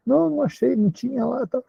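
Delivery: put-on a high, casual young woman's no; Bruna denying. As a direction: brush it off in Bruna's voice. Bruna's acted denial.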